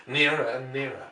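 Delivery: plain reading voice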